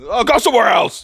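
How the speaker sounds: high, loud shout back; hammed-up high-pitched yell; yelled retort. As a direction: shout it back at the whole bar. Lion yells back at bar.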